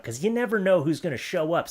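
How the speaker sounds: ordinary talk, nothing marked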